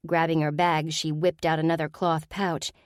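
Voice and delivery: usual speaking voice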